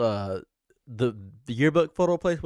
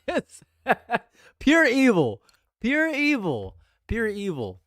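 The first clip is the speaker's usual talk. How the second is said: dramatic pure evil; 7 semitones higher, slower than usual. Real, not acted. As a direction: proclaim it like a campy villain line, then let it fade. Laughing, calls the burger evil.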